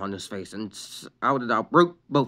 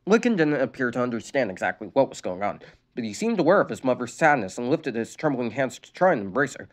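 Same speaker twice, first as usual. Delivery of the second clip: plain reading voice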